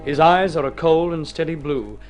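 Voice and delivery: usual speaking voice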